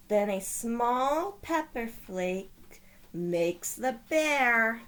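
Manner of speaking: put-on lively read-aloud voice for children; storybook narration. A narrator's reading. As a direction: tell it like a bedtime picture book. Reading picture book aloud.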